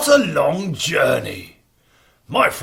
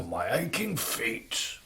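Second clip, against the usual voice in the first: weary unvoiced whisper; 5 semitones lower, 11 dB quieter than usual. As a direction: breathe it out, worn out. Giant tired after journey.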